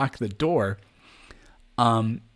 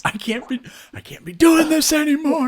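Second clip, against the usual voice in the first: mock breakdown; 6 dB louder than usual. Cohost echoes the breakdown quote.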